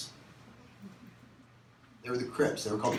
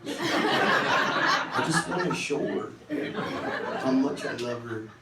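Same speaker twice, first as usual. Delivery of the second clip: slow, drawn-out lovesick pleading; mock lovestruck teenager; lovesick self; slower than usual. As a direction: swoon over the girl. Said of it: "it'd be like".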